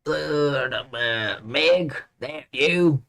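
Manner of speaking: put-on lightly Southern old man; Southern accent. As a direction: drawl it, Southern grandpa. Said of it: "I made him southern".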